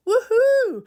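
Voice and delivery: high, loud happy whoop after the run; real joyful whoop. Real, not acted. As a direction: cheer for your own five kilometres. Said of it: "So happy with that".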